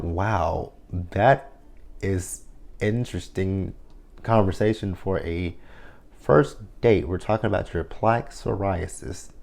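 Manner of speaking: quiet, slow, dry sarcasm; mock politeness, softly unimpressed; sarcastic deadpan. Talking back to the TV date in quiet deadpan sarcasm. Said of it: "And I'm like".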